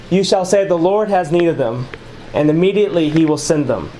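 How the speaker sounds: ordinary talk, nothing marked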